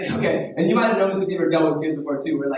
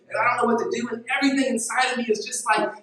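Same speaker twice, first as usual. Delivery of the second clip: higher and louder, pleading over the music; impassioned preaching; 8 semitones higher, 3 dB louder than usual. Acted. Preacher telling the congregation about helping his son. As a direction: let it swell with feeling. Preacher builds to emotional peak.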